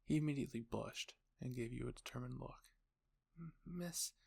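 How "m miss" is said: put-on bashful boy stammer. Shy boy addresses his crush.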